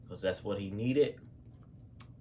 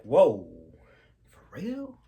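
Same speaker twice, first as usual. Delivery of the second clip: alarmed gasp; 3 semitones higher, 9 dB louder, slower than usual. Retold or imitated. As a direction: gasp it out in shock at the news. Parents panic at gun news.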